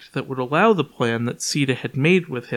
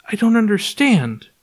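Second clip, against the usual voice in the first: higher, louder, a touch of alarm; shaken; 7 semitones higher, 4 dB louder than usual. A narrator's reading. Sita hears Clark has no skin lines, mildly shaken. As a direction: say it with mild rising panic. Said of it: "her hands shaking".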